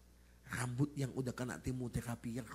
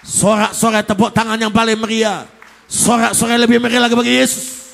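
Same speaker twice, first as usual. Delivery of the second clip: shouted, much louder and higher; full-force preaching; 10 semitones higher, 27 dB louder than usual. Real, not acted. Pastor calls congregation to cheer for Jesus, shouting. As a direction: rouse the crowd to applaud.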